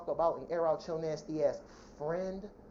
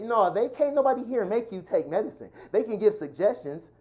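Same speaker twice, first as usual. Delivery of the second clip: ordinary talk, nothing marked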